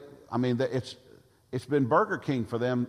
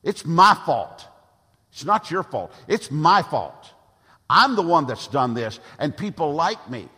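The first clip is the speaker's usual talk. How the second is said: shouted confession; 3 semitones higher, 8 dB louder than usual. Real, not acted. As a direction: take the blame at full voice. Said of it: "I'm yelling at me".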